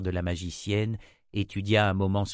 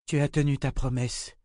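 tired, higher woman's voice, a little lifted; weary tone; 5 semitones higher than usual. A narrator's reading. Sorceress thanks the Seeker, weary. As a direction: speak it worn out but grateful. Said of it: "dit Nietzsche d'un ton las".

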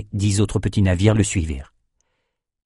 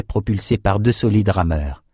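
usual speaking voice

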